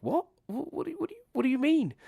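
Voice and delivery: high, baffled questioning; incredulous what. Real, not acted. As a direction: pitch up in disbelief. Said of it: "incredulous reaction".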